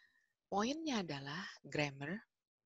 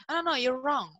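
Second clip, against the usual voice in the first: higher, louder mock scolding; grammar Nazi; 8 semitones higher, 7 dB louder than usual. Grammar stickler correcting a learner, high, loud and insistent. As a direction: cut in as a pedantic corrector. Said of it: "terus di grammar Nazi ini nanti".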